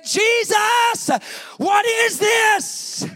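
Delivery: high, loud, drawn-out cry to Jesus; impassioned cry. Retold or imitated. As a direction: cry out loudly, stretching the last words. Cries out to God in testimony.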